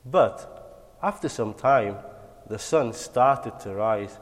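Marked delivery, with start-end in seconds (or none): none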